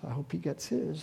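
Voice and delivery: soft, low aside; muttered ill wish. Acted. Muttered ill wish, acted confession.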